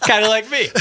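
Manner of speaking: real chuckle through the words; laughing. Real, not acted. Host laughs at co-host's joke.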